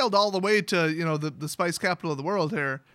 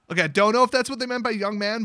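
ordinary talk

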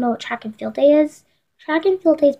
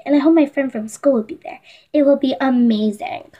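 ordinary talk, nothing marked

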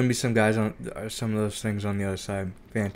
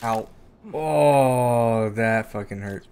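sudden loud cry of real pain; pained outburst; 2 semitones higher, 8 dB louder, much slower than usual. Real, not acted. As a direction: yelp it out, it really hurt. Player hurts themself, cries out.

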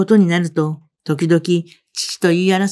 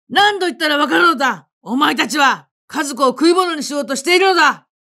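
higher pitched angry outburst; father loses patience with the mother, raised and angry; loud angry father; 7 semitones higher than usual. A narrator's reading. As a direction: snap at her, voice raised in anger. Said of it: "と大きい声でおっしゃって".

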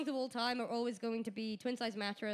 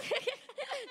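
laughing; 13 semitones higher than usual. Real, not acted. Laughing at the answer.

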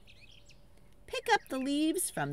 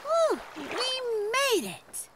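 1 high relieved whew; 13 semitones higher, slower than usual. Pretend. Relief after lake.

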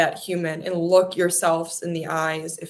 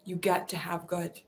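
soft echo; 9 dB quieter than usual. Acted. Repeated affirmation, softer echo.

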